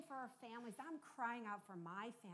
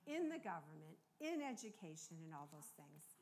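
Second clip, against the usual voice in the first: earnest prayer; 4 dB louder than usual. Real, not acted. Leads the congregation in prayer.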